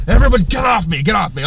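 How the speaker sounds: loud acted protest from under a pile; exasperated yell. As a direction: shove them off with your voice, loud. Bear buried under pile of animals.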